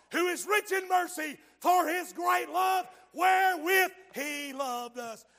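loud, high shouted scripture; thundering revival preacher; preaching at full force. Acted. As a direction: hammer each verse home.

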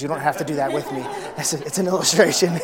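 laughing while he talks, real chuckle; amused, words breaking into laughter. Real, not acted. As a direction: talk through a laugh at his own joke.

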